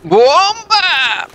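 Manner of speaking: sudden high shout of warning; grenade warning yell. Real, not acted.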